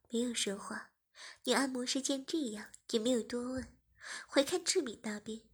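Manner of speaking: ordinary delivery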